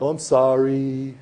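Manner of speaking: drawn-out mock sorry; insincere apology. Snowboarder saying sorry with attitude, insincere apology. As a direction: stretch it out like you don't mean it. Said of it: "like, kind of that attitude".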